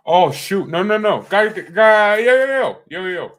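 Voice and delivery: high, loud rapid-fire exclamations; sudden alarm. Real, not acted. Streamer startled mid-stream, sudden loud alarm.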